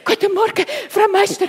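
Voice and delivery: loud, high out-of-breath greeting; panting. A narrator's reading. Till greets Frau Meister after storming in, panting. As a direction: gasp it out between heavy breaths. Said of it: "kreucht Till".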